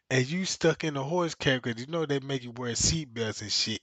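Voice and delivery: usual speaking voice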